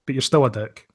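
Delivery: ordinary delivery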